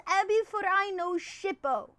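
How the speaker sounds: put-on high, loud go at a Japanese accent; non-native Japanese accent. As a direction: say the name with an amateur Japanese accent. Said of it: "my Japanese accent is not native".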